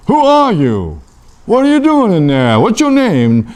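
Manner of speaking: high, urgent questioning; bewildered demanding. Acted. Acted questioning of the bush.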